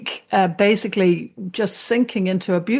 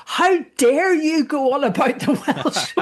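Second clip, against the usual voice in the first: higher, hammed-up indignant laughing voice; mock high horse; 6 semitones higher than usual. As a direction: play a pompous outraged neighbour, half-laughing. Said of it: "got on their high horse and went".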